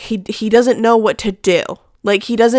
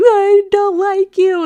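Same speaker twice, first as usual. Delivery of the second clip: high, whiny mock crying; slow, high-pitched imitation of a tearful kid; imitated crying; 11 semitones higher, 4 dB louder, slower than usual. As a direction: draw it out like a child wailing. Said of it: "he'll just, like, cry".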